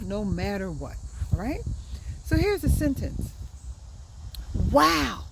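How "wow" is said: excited wow. Shown as a demonstration. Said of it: "the interjection is the word wow".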